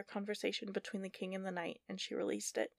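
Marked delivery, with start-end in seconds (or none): none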